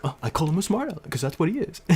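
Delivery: high, fast, snooty put-on voice; mock the teacher's smug excuse; snide teacher. Snide teacher quoted by narrator.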